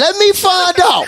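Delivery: high, loud, slowed challenge; mock-outraged shout. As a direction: call out a friend in playful outrage. Mock accusation over the beard.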